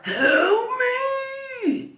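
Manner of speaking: high, strained cry of help me, not a scream; strained call for help. Retold or imitated. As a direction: imitate a weak man straining to call out. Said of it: "It wasn't, like, a scream".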